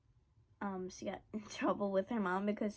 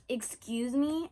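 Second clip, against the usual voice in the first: slow, louder indignant "Excuse me?"; offended drawn-out excuse me; 2 semitones higher, 4 dB louder, slower than usual. Retold or imitated. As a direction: stretch it out, offended. Friend offended by insult.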